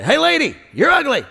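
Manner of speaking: high squawky parrot taunt; yelling parrot. Acted. Parrot heckling lady outside pet store, yelling. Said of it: "the parrot yells every day".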